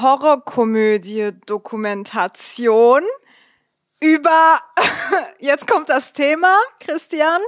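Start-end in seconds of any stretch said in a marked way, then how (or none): 4.0-7.4 giggling through words; laughing. Real, not acted. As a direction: laugh while announcing. Laughing while reading card.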